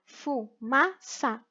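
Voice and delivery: "Fumaça" slowly split as fu, ma, ça; syllable by syllable. Shown as a demonstration. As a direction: sound out "Fumaça" with a gap after each syllable. Joins syllables to form the word.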